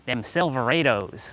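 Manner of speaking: slow, drawled mock Southern accent; mock a Southern drawl, long AY in Silverados; badly faked Southern twang. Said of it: "straight up mockery".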